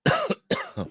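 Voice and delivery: sharp choking cough; coughing after swallowing wrong; coughing up water. Real, not acted. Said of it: "That one went down the wrong pipe".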